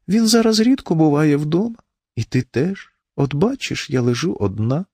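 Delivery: high, feeble complaining voice; plaintive wife. A narrator's reading. Wife complains to husband of lying alone, plaintively.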